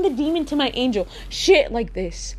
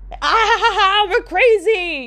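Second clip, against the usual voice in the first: high hammed-up cackle; mock crazy laugh; 7 semitones higher, 3 dB louder than usual. Gangster couple cackling at their own craziness, hammed-up. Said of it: "couples who think they're gangster together".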